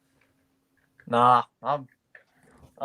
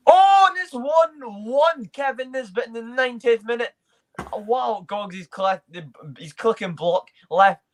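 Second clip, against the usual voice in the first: excited commentary; 8 semitones higher than usual. Acted. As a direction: call the late goal like a commentator. Live commentary on a late equaliser.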